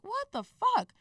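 high-pitched disbelief, not shouted; incredulous reaction. Retold or imitated. Woman reacting to her date drinking at the bar, incredulous. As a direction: retell it as a squeaky, incredulous outburst.

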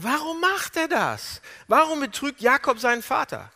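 high, loud, anguished demand; impassioned questioning. Acted. Preacher asking why Jacob deceives his father, impassioned. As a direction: cry the question out in disbelief.

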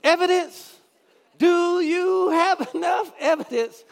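high, shouted preacher impression; imitating Fred Price. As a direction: ham up the TV preacher's call. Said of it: "That show used to come on".

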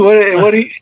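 protest, stunned and put out; indignant outburst. Real, not acted.

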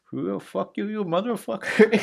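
high-pitched, badly faked Indian accent; terrible Indian accent. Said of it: "that was a terrible accent".

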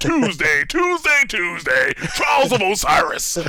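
booming monster truck rally announcer. Retold or imitated. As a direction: shout it like a hyped arena ad. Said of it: "advertising for a monster truck rally".

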